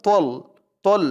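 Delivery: "তল" with a thick emphatic t, rounded vowel; correct heavy ta. Shown as a demonstration. Teacher showing the letter's sound, "তল" heavy and rounded. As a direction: say "তল" full-mouthed, like "tol". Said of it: "তোয়ার উচ্চারণ".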